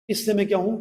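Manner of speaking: ordinary talk, nothing marked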